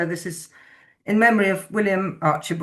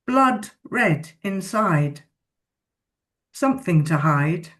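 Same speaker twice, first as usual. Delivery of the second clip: poem reading; 3 semitones higher than usual. Acted. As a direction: give each word its own beat. Reads a poem aloud.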